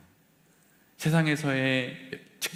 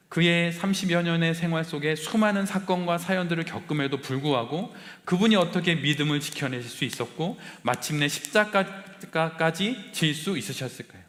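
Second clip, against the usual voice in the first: normal talking voice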